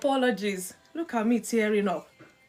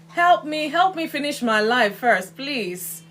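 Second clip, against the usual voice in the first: mock desperate plea; 5 semitones higher, 6 dB louder than usual. Real, not acted. Coughing from cooking fumes, mock plea.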